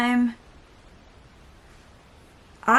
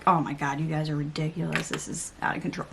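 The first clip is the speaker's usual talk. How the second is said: fed-up dad; 4 semitones lower, 6 dB quieter, faster than usual. Retold or imitated. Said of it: "a dad who's just sick and tired of it".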